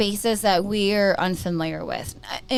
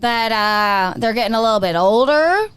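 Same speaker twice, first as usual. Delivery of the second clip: put-on country accent; 7 dB louder than usual. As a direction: play it up like a Southern storyteller. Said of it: "Can you use a country accent?".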